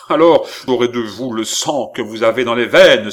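loud, furious threat; vengeful cry. Acted. Rebuffed brother threatens the count's life, crying out. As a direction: burst out demanding blood. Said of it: "s'écria Philippe".

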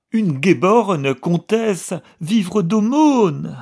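high, loud, slowing tearful tirade; widowed countess shouting out her shame; furious wailing outburst. Acted. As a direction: rage at the humiliation, near sobbing. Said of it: "les exclamations recommencèrent".

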